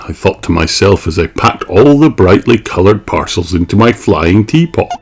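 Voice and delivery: ordinary delivery, nothing marked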